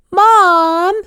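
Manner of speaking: high, loud, drawn-out whine; protesting child. A narrator's reading. Son objecting to his mother's orders, whiny protest. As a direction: wail it in protest as a kid. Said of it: "he protested".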